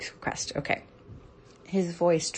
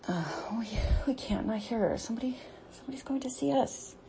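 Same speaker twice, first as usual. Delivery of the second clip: anxious whisper; 6 semitones higher, 3 dB quieter, faster than usual. Real, not acted. Worried whisper about being caught.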